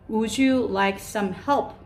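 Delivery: slow, careful model question; slow polite offer. Shown as a demonstration. Teacher offering a child help, slow and polite. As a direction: offer help gently, word by word. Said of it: "라고 하실 수도 있어요".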